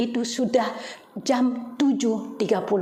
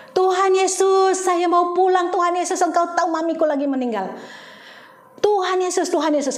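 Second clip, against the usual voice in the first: high, loud, pleading cry to Jesus; calling out to God like a fool, voice pitched high and loud; frantic prayer shouted high and loud on a jammed toll road; desperate crying out; 8 semitones higher, 3 dB louder than usual. Retold or imitated. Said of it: "saya teriak-teriak kayak orang bodoh".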